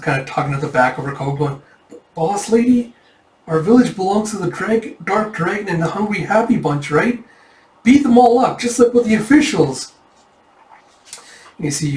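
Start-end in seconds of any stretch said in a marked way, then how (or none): 2.1-9.9 high-voiced urging, building louder; child pleading. Kid tugging boss lady's coat, high urgent plea. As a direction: urge the boss to fight. Child begs her to fight.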